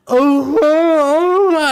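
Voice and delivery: a mom recoiling at the mess; startled cries; shocked parent. Retold or imitated. Parent reacting in horror.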